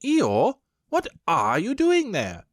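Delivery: mild rabbit voice. A narrator's reading. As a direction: call down briskly as Rabbit. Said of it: "said Rabbit".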